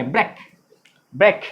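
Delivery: ब्रेक cut short as "brek"; short vowel. Shown as a demonstration. Teacher explaining breakfast, short snapped "brek". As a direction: say ब्रेक quickly, vowel not stretched. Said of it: "ब्रेक को खींचेंगे नहीं".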